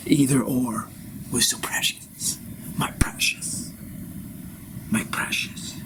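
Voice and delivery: breathy Gollum whisper hissing over precious. Acted. Guru rasps like Gollum over his precious idea. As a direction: whisper it like a creature clutching treasure.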